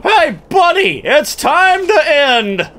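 high, slow hammy doom cry; doomsday street preacher. Pretend. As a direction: warn of the end, hammy. Menacing silly call.

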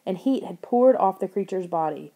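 ordinary delivery

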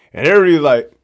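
plain reading voice

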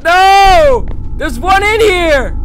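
high, loud frantic shouts; panicked yelling. Real, not acted. Chased, searching frantically.

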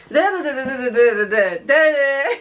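mock chatter in nonsense syllables; playful stream of da-da syllables; drawn-out babble. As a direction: babble on to mimic endless talking. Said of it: "and draw it out".

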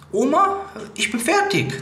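lightly voiced old granny sighing she's done; a hint of a grandma. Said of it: "Das sagt doch deine Oma".

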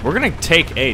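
ordinary talk

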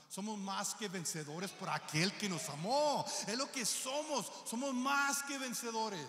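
loud, drawn-out proclamation; rousing preaching. Real, not acted. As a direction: declare it slowly at full force. Preacher rallies congregation, emphatic declaration.

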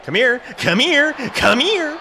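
high, increasingly urgent calling out; building call. Real, not acted. Gamer chasing down an opponent, high building call. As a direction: call after them, rising in pitch.

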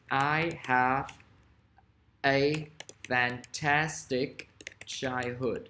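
word by word with long pauses; very slow, stretched-out example sentence; slow dictation. Shown as a demonstration. Teacher dictating an example to students, slow and broken up.

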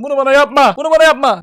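loud, high, exaggerated pleading; over-dramatic begging; mock desperate plea. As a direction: plead loudly as if wronged. Pleading with viewers over falling views.

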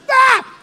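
short, loud, high-pitched cry; sudden shrill outburst; mock wail. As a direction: yelp it like a hammed-up mourner. Imitates mourners throwing dirt.

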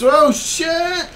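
sudden high yelp of surprise; startled shout. Real, not acted. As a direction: caught off guard, shout it out. Caught off guard in game.

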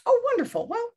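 real, high-pitched joy at the praise; delighted surprise. Real, not acted. Author thrilled by a reader's comment, delighted and high. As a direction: pitch jumps way up on a delighted "Oh, wonderful".

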